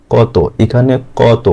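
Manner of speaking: slow "কত" with an open "aw" in ক; open অ vowel. Shown as a demonstration. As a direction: say "কত" as kaw-to, drawn out. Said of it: "অ এর মতো উচ্চারণ করছি".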